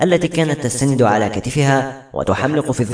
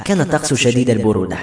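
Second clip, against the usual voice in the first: plain reading voice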